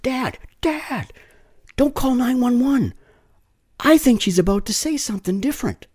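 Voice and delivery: put-on high, urgent child's voice calling Dad; kid calling out. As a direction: call out to your dad from the car window. Kid calls from car window.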